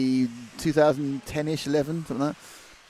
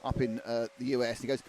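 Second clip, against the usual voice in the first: usual speaking voice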